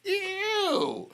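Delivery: very high, squealing ew of disgust; a cartoonish, high-pitched ew like a comedy movie; Chris Tucker ew. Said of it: "like Chris Tucker in Friday".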